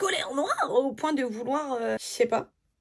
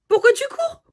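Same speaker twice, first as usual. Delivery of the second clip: much higher, louder startled shout; alarmed cry; 14 semitones higher, 8 dB louder than usual. Retold or imitated. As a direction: yelp in disbelief at him running. She sees him running out.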